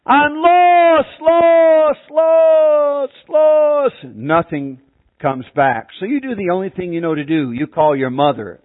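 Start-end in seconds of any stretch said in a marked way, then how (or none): 0.0-4.0 desperate scream. Acted. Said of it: "So you scream over here".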